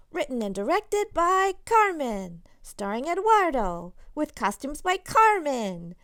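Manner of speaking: loud, grand program announcement; announcer read. Acted. Narrator reading the family show program, loud and grand.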